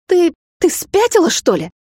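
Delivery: panic, stumble on the first word; nervous outburst. A narrator's reading. Vampire to the girl who spilled the juice, high nervous outburst. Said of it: "занервничал вампир".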